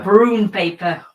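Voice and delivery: brown paper as broon, slow and loud; drawn-out Scottish broon paper; Scottish accent. Shown as a demonstration. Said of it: "Say it in a Scottish accent and it makes sense".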